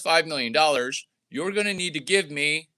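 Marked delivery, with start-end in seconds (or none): none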